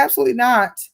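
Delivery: emphatic second take; loud high no. Shown as a demonstration. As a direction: say it again with force. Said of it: "I said".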